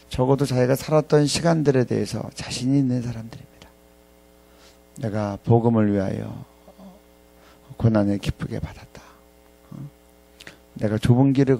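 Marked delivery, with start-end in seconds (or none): none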